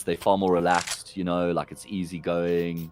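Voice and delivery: a laid-back Cape Town South African lilt; relaxed Cape Town accent. Shown as a demonstration. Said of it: "people talk a bit more like this".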